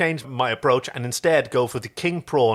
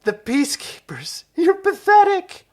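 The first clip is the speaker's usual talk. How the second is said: high, loud, laughing through the words; sputtering laugh; 5 dB louder, slower than usual. Acted. Chiana mocking the peacekeeper, laughing and sputtering. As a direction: choke out the insult between laughs. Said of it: "sputtered as she tried to catch her breath".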